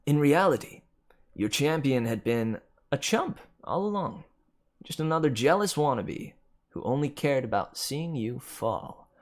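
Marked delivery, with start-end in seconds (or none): none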